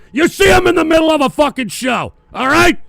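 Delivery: loud, high, furious outburst at the chat; angry yell. Real, not acted. Streamer yelling at donors who interrupt his show, angry shout. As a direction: snap at the donors, full volume.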